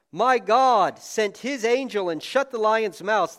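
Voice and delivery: loud, high, slow proclaiming of the verse; slow loud scripture reading. Acted. Daniel answers the king, read out slow and loud. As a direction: linger on each word of God's rescue.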